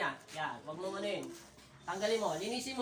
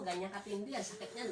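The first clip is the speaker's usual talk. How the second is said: ordinary talk, nothing marked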